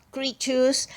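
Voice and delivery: ordinary delivery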